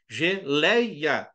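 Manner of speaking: GELEIA in syllables, weight on LEI; falling diphthong. Shown as a demonstration. Teacher repeating the example, "Geleia" split with strong LEI. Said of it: "O E começa alto e cai pro I".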